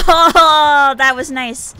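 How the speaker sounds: excited. Real, not acted. Surprise escape in game.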